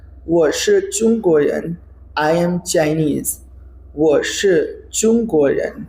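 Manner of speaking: 我是中国人 said very slowly, syllable by syllable; slow drill reading. Shown as a demonstration. Teacher reads Li Wen's line slowly for learners. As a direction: spell out 我是中国人 at a crawl. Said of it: "आपको दिखा देता हूँ".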